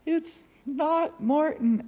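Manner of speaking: high, loud, drawn-out mock-Scottish call; slow Scottish holler from afar, hammed up; Scottish accent. Morton upstairs teasing her, in a loud Scottish accent. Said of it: "with the Scottish accent".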